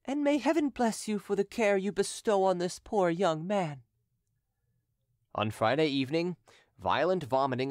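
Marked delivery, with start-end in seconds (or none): 0.0-3.8 a hint of Madame Martin, higher and heartfelt; mild tearful thanks; lightly voiced grateful woman. A narrator's reading. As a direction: bless him with simple gratitude. Madame Martin blessing Derues.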